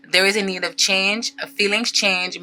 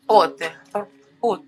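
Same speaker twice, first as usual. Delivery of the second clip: mock-seductive French pronunciation; sexy French accent; slower than usual. Shown as a demonstration. Tarot reader trying out the card's French word, sexy French accent. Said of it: "say it in a sexy French accent".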